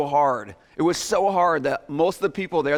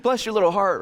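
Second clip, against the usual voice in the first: grandmother's voice. Retold or imitated. Grandmother blessing a grandchild, lightly voiced Bless your little heart. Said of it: "I think of my grandmother".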